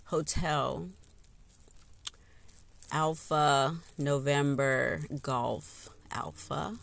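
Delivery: usual speaking voice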